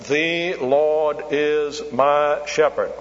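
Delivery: very slow, every word given equal weight; a beginner's even, word-by-word recital; plodding child's reading. Retold or imitated. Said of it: "that's not the way to read it".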